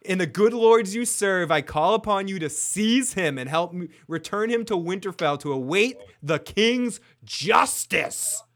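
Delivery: rally the room against him; intense outburst. Retold or imitated. Catelyn calls on the knights to seize Tyrion, loud impassioned command. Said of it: "and then her voice goes crazy".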